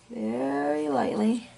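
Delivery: slow, soft "Very lightly" to model a light touch; softly drawn out. Shown as a demonstration. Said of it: "just lightly".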